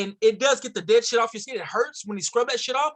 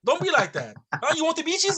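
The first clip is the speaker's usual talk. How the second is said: lightly mimicked Middle Eastern-accented seller; mock Middle Eastern accent, cajoling; 7 semitones higher than usual. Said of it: "it was a Middle Eastern origin accent".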